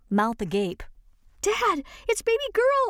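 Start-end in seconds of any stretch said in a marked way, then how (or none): 1.4-2.9 high, loud burst of joy; excited cry. Acted. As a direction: shout it out, overjoyed to find her cat. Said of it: "I cried".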